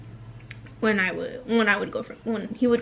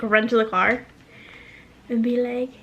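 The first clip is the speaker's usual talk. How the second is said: normal talking voice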